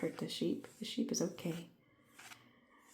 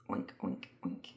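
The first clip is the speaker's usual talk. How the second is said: much lower oinks, grunting; low pig grunt; 13 semitones lower, 5 dB quieter than usual. Shown as a demonstration. Teacher celebrating the pig piece with low oinks.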